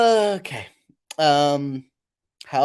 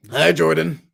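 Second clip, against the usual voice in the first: character greeting; 3 semitones higher, 8 dB louder than usual. Acted.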